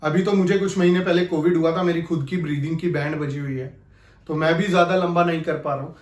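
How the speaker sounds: normal talking voice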